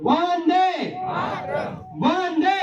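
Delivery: high, sustained rallying shout; slogan call. Real, not acted. Rally speaker prompts the crowd to answer, rousing slogan call. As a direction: pitch it up and hold it for the crowd's response.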